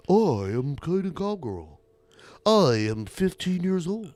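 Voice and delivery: mock radio voice with swooping pitch; put-on broadcaster voice; Caden Cowgirl. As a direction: imitate a kid doing a radio host. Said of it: "even Caden Cowgirl had a better radio voice".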